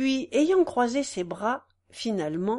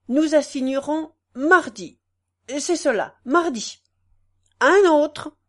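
loud, higher dictation to the clerk; judge dictating; 5 semitones higher, 7 dB louder than usual. A narrator's reading. Judge dictating to the clerk, loud. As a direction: bark out the orders.

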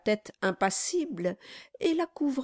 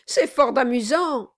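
fawning lawyer; 5 semitones higher, 6 dB louder than usual. Acted. Brass flattering.

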